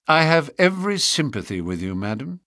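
usual speaking voice

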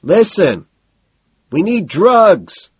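high, loud, drawn-out shout; exasperated holler; losing his cool. A narrator's reading. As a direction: yell it with impatient urgency. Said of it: "I think I hear him shout".

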